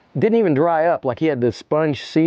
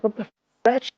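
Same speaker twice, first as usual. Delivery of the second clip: stunned disbelief; 9 semitones higher, much faster than usual. Retold or imitated. Stunned at friend running away.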